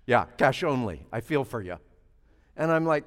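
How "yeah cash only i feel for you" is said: low, soft mock sympathy from the clerk; curt clerk. Preacher imitating the clerk's fake sympathy, low. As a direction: lightly voice a dismissive clerk.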